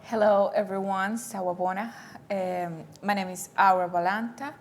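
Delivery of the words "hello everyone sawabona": ordinary talk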